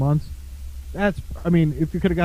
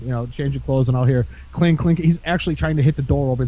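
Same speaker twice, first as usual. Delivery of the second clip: ordinary delivery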